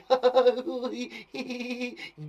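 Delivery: long, high put-on giggle; exaggerated high tittering; demonstrated giggle. Shown as a demonstration. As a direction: let a giggle tumble out on purpose.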